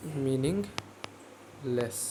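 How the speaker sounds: extremely slow, pause before the last syllable; drawn out. Real, not acted. Teacher writing the answer for pupils, very drawn out. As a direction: stretch meaningless right out, breaking before लेस.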